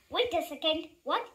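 plain reading voice

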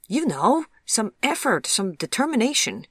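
high, loud and emphatic; spirited explaining. A narrator's reading. Chip explains slang to Vivian, spirited. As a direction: urge her on with real gusto.

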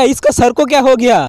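usual speaking voice

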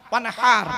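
ওয়ানহার with a strong h from the throat, said loud; ওয়ানহার, h breathed hard from the throat; throaty h in wanhar. Shown as a demonstration. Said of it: "গলার ভেতর থেকে হে এটা উচ্চারণ করবে".

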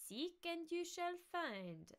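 high, slow nasal pronouncement; nasal mock-sage delivery; nasal character voice. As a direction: intone it slowly through the nose. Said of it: "I think I lost the nasal".

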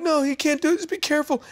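put-on high anxious pleading kid; eight-year-old begging his brother to be careful; scared plea. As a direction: beg him in a high scared child voice.

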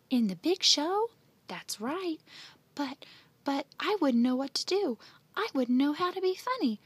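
put-on a boy who can't believe his luck; eager stammering astonishment; excited disbelief. Andy amazed, offered clown job.

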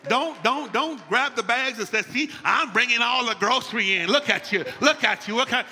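loud, high-pitched nagging imitation; mocking scolding. Husband acting out a wife grumbling over groceries, scolding. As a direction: taunt loudly, hammed-up complaint. Acts out a wife's scolding complaint.

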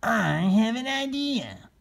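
high, slow scheming turkey. Acted. Solomon asking his friends about the feather bags. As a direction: lay out the plan slowly. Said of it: "When Solomon had explained".

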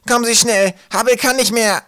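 high, loud cry for help; frantic high shout. A narrator's reading. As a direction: yell in alarm. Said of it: "schrie Zacke".

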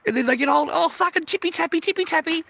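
high, loud fake sobbing whine; mock whiny crying. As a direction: mimic her crocodile tears. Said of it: "a little cry on the phone".

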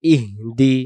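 "ইহদি" as breathed ih, then di; broken into parts. Shown as a demonstration. Teacher breaking the word up, "ইহদি" as ih-di. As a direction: stop on a soundless h in "ইহদি", then দি. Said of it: "গলা ছেড়ে পড়বো".

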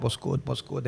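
lightly put-on London street greeting; London road-man accent. Imitating his London mates, light road-man accent. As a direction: slip into London slang delivery. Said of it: "it was just London".

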